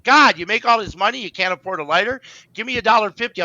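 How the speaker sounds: acted-out exasperated ribbing; mock exasperation. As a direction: imitate a girlfriend playfully ribbing someone. Girlfriend teasing a wrestler in retold quote.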